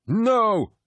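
loud, very high cry of refusal; bellow. A narrator's reading. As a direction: bellow it in protest. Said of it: "bellowed the War Master".

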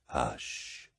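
breathless whisper, cutting him off. A narrator's reading. War Master silences Loken's confession in a whisper. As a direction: hush him like a worried child. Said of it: "Horus whispered".

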